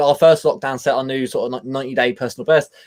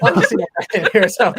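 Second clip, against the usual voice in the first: laughing; 7 semitones higher, faster than usual. Real, not acted. Laughing about the cold.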